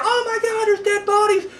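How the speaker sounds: high mock panicked cry; hammed-up horror-movie panic. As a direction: squeal it like a scared teen. Retelling a character's panic.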